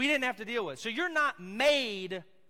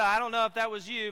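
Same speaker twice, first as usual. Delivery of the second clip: usual speaking voice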